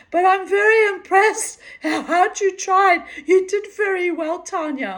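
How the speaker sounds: put-on very high, loud German-accented praise; hammed-up German teacher, laughter in the voice; imitated German instructor. German instructor praising his pupil, imitated German accent. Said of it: "also as he said".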